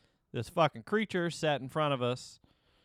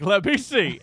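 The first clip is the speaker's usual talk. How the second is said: pushy insisting; 6 semitones higher, 10 dB louder, slower than usual. Retold or imitated. Creep insisting, retold louder.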